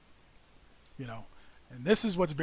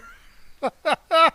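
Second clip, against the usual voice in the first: loud laugh; 11 semitones higher, 10 dB louder than usual. Real, not acted. Laughs while unable to verify.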